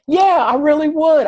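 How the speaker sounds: eager wife. Retold or imitated. Beth voiced eagerly accepting.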